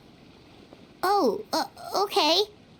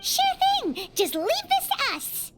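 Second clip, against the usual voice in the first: high, bright-eyed volunteering; eager; 13 semitones higher than usual. Acted. Companion eagerly promising the therapist to investigate, excited. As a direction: jump at the chance to help.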